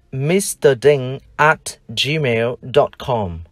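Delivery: slow, careful English sentence, each word apart; slow example read. Shown as a demonstration. Teacher reading an email address slowly to the class. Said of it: "Ví dụ".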